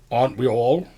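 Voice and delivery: the father answers from behind, loud; father's voice. Acted. Father calling out from behind his son, loud. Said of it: "a familiar paternal voice from your childhood".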